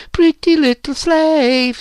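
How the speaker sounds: very high, loud, drawn-out shriek; screamed taunt. A narrator's reading. Virginia goads Phyllis into a fight, screaming. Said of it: "screamed Virginia".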